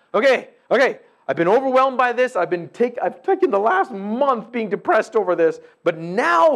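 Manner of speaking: loud, high, rushing confession; eager testimony. Retold or imitated. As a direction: stir yourself into resolve. Voicing a believer's resolve.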